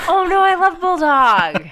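high, loud, drawn-out protest; gasp, then wail it out loudly; dismayed fan wail. Real, not acted. Fan hearing Bulldog is on the hated list, dismayed wail. Gasps at favourite character being listed.

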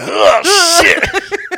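high, loud gasped exclamation with a laugh; acted shock. Storyteller feeling the sudden weight on him, high startled yelp. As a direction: exaggerate a startled gasp into the words.

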